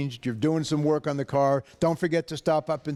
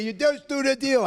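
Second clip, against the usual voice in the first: a higher mock yell of the slogan; imitating his TV yell; 10 semitones higher than usual. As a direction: echo the TV shout half-jokingly. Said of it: "yelling and screaming over".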